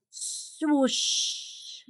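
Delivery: usual speaking voice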